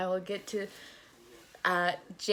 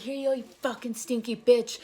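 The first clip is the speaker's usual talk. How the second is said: high, jeering retold insult; hammed-up gleeful taunt; mock taunt; 6 semitones higher, 3 dB louder than usual. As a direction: toss the insult out in a pitched-up voice. Taunting roommate while throwing deodorant.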